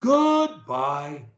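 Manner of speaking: GOODBYE with GOOD held high, BYE dropping; start up high. Shown as a demonstration. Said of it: "you start up high".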